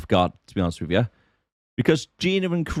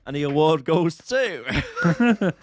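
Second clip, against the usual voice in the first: announcer voice; 5 semitones higher, much slower than usual. Pretend. Mock awards announcement.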